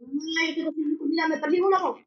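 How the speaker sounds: normal talking voice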